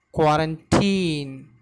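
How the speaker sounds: "Quarantine" slow and deliberate, long final syllable; stress demonstration. Shown as a demonstration. Teacher showing learners the word's stress, slow deliberate "Quarantine". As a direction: model "Quarantine" slowly after explaining its stress. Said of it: "the stress is given on very first syllable".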